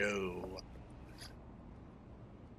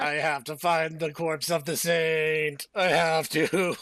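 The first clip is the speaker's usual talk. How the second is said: slow, dramatic insistence, building louder; mock desperation; 5 semitones higher, 7 dB louder, slower than usual. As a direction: declare an obsessive quest, hammed-up. Mock-desperate answer to scolding.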